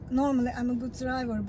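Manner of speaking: ordinary talk, nothing marked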